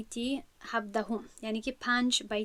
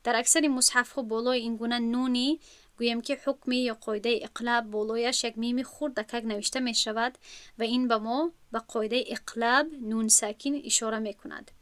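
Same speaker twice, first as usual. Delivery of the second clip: ordinary talk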